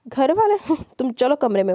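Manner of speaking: mock the word family, then order her off; scornful scoff. A narrator's reading.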